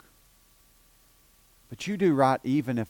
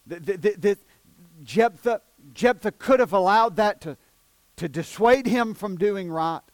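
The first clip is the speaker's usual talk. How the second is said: louder and higher, a building pulpit cry; full-force preaching; 7 semitones higher, 6 dB louder than usual. Acted. Preacher to the congregation about Jephthah, full-force preaching. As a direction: preach it hard, climbing.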